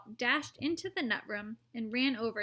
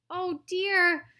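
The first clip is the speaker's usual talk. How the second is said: cried out; 7 semitones higher, 7 dB louder than usual. A narrator's reading. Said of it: "cried Charlie".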